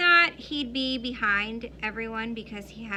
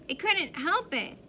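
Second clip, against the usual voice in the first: a slightly higher whiny plea; whining; 6 semitones higher than usual. A narrator's reading. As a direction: whine it like a caught kid. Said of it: "kind of whining".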